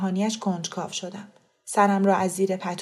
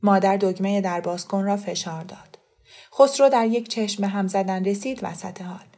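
plain reading voice

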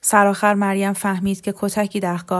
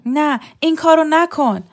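loud, much higher desperate "نه، این کارو نکن"; frightened plea; 8 semitones higher, 7 dB louder than usual. A narrator's reading. Frightened wife begging husband not to hit her. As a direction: beg him not to strike. Woman begs before a beating.

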